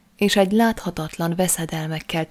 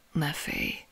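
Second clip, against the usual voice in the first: soft whisper right into the ear; hushed, intimate reassurance; 2 semitones lower, 9 dB quieter than usual. A narrator's reading. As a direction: breathe it reassuringly, barely voiced. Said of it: "súgta a fülembe".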